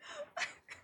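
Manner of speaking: airy high giggle; silent-ish cackle at herself; breathless squeaky laugh; high wheezing laugh. Real, not acted.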